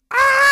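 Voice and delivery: sudden high-pitched scream; loud scream. Acted. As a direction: let out a sharp shriek.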